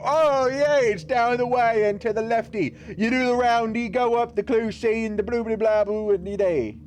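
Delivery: high, babbling fake British accent; hammed-up British accent; mock British accent. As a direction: gibberish directions in a British accent. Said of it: "they'll perfectly understand and go".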